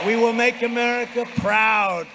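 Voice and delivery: loud, high slogan call; roaring rallying speech. Real, not acted. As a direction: ring it out to the crowd. Closing crescendo of rally.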